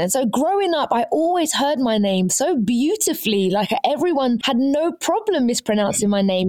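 usual speaking voice

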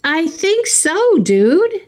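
high, slow, lightly voiced Jose; mock Jose. Imitating Jose answering about Holly's brother, high and slow. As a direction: drawl it out, dopey and unsure. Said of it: "Jose goes".

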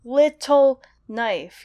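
little knife slow, every syllable weighted; spondaic stress. Shown as a demonstration. Host reading the spell line aloud, slow heavy stresses. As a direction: lean hard on little and on knife. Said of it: "two consecutive stressed syllables".

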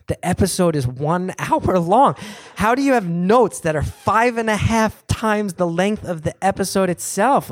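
high, slow, mildly exasperated retelling; exasperated complaint. Retold or imitated. Comedian to executive about endless notes, mildly exasperated. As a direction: spell out the absurdity in mock disbelief.

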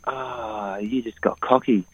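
quiet retold moment of realising; hushed realisation. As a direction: drop to a soft inner voice as it dawns on you. Retold sudden inner realisation underwater.

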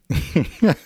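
a quick high chuckle; short laugh. Real, not acted. Teacher laughing after the example. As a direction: giggle after the line.

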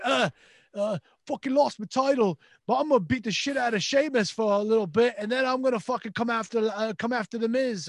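lightly voiced Scottish wrestler accent; a hint of a Scottish brogue; Drew McIntyre accent. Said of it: "It was a McIntyre accent".

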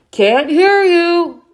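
high, slow yelled reply; yelling back. A narrator's reading. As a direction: shout back over the noise. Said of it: "Dad responded".

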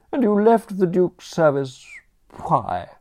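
high, loud, withering dowager voice; haughty aunt. Acted. Aunt to nephew Bertram, despairing grande dame scorn. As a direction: look down your nose at the nation's ruin.

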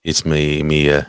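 put-on light Australian accent; lightly voiced Australian accent; Grayson Waller. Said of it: "Grayson Waller".

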